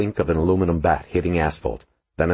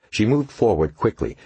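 plain reading voice

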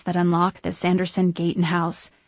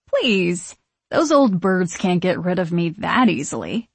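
plain reading voice

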